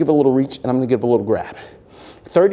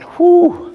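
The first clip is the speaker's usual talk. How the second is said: loud, high exhale of relief; high relieved whew; 6 dB louder than usual. Real, not acted. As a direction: blow out a big relieved whew. Relief after the set.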